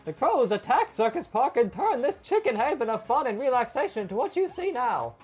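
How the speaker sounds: high, loud theatrical ringmaster; Alani voice. Acted. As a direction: boom it out like a circus elder. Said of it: "I always do a certain voice for him".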